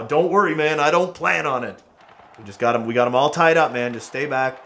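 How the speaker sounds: ordinary talk, nothing marked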